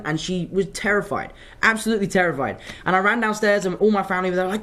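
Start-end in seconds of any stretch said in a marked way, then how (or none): none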